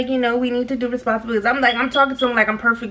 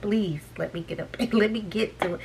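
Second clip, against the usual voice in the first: quiet plea; 2 semitones lower, 9 dB quieter than usual. Retold or imitated. Begging to get out.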